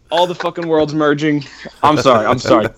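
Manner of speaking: ordinary talk, nothing marked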